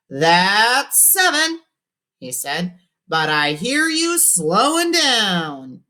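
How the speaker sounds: high, slow showy radio host. A narrator's reading. Cousin Ralphie answering the caller, showy radio DJ. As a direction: sell it like a DJ on air.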